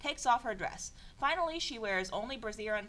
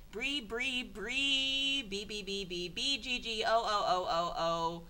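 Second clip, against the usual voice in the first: imitated fart noises; slower than usual. Voices fart sounds from text.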